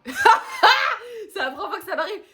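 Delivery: high, drawn-out words through laughter; laughing hard. Real, not acted. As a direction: squeal it out while cracking up. Laughing about having cried.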